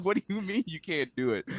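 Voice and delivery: high, fast mock indignation; comically exasperated protest; retold mad outburst. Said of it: "and I got mad".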